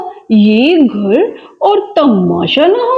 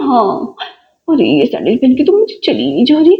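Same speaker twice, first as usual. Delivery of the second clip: ordinary talk, nothing marked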